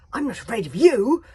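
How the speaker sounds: a scared boy shouting fake confidence; loud forced bravado. A narrator's reading. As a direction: shout as loud as he can to sound brave. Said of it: "announced Hiccup as loud as he could".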